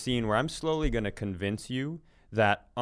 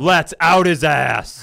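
loud, slow, hammed-up vengeful call; mock vengeance; 4 semitones higher, 13 dB louder, slower than usual. Cohost egging on a revenge on the driver, mock vengeful yell. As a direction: ham up the thirst for revenge. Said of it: "I've got this vengeance inside me".

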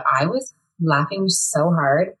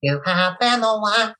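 [name] impression; 3 semitones higher, slower than usual. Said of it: "impression of [name]".

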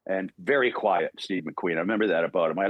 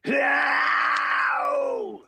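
feral battle cry. Acted. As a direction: let out a wild animal roar to finish the line. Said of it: "this is the first line".

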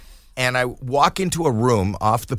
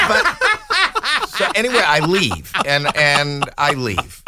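usual speaking voice

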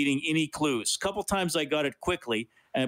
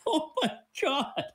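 laughing; 10 semitones higher than usual. Real, not acted. Host still laughing.

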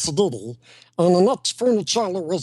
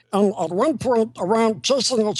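ordinary talk

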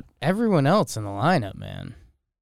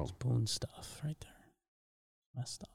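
whisper; 13 dB quieter than usual. Real, not acted. Whispered aside.

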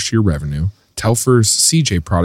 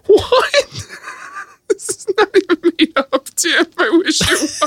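laughing disbelief. Retold or imitated. Retold own astonishment, laughing.

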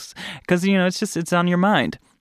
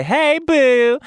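imitating fans; 11 semitones higher, 4 dB louder than usual. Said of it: "They say".

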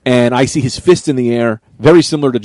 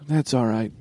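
soft reply; 11 dB quieter than usual. Retold or imitated. Girlfriend answering him after he lets it go, softly. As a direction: play her hushed, quiet answer. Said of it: "she looks at me and she goes".

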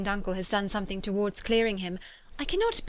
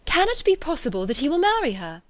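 higher-pitched incredulous question; astonished cry; 6 semitones higher than usual. A narrator's reading. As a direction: ask in amazement. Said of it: "cried Elizabeth, when she had finished".

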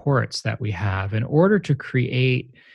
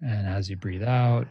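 guided meditation. Shown as a demonstration. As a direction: lead a guided meditation, unhurried. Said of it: "let's meditate together right now".

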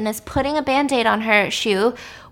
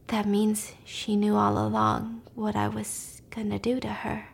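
whisper; 7 dB quieter than usual. Real, not acted. Recapping the plot to a friend, in a hushed whisper. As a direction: lean in and whisper the theory.

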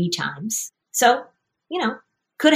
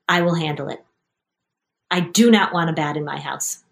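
ordinary delivery

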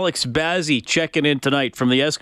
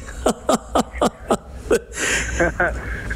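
breathy laugh; 6 semitones higher, 4 dB quieter than usual. Real, not acted. Host laughs at guest's joke.

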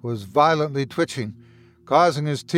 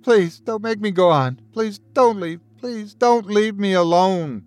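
panicked plea; 5 semitones higher, 3 dB louder than usual. Acted. Panicked sobbing plea to brother.

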